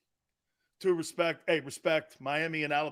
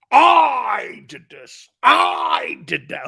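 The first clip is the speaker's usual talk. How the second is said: slow, much louder, higher gloating shout; mock GM bragging; 6 semitones higher, 9 dB louder, much slower than usual. Mocking general managers who take the credit, slow bragging shout. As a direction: crow it like a credit-grabbing GM.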